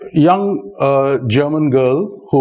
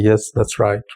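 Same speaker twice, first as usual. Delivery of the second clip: flat monotone reply; 4 semitones lower than usual. Retold or imitated. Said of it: "I always talk at one tone".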